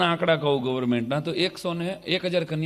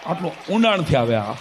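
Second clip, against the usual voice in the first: ordinary talk, nothing marked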